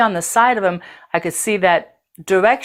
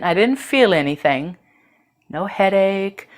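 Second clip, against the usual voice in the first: ordinary delivery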